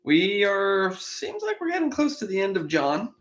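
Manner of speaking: ordinary talk, nothing marked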